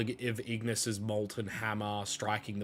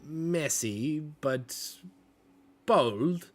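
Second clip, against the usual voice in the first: higher, airy artist voice; artsy Mila; 7 semitones higher than usual. Acted. Mila praises the newcomers' bold mess, higher and airy. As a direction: admire the mess like a painting. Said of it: "Mila then speaks up".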